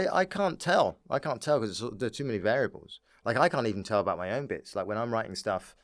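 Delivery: usual speaking voice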